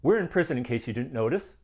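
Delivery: stunned, sarcastic retort; incredulous sarcasm. Retold or imitated. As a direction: say it like he's missed the obvious. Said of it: "with my mouth open and went".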